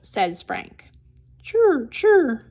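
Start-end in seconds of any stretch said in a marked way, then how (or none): 1.4-2.4 high, loud chirring; cricket chirr. A narrator's reading. Cricket sounds.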